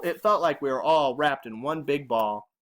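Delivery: ordinary delivery, nothing marked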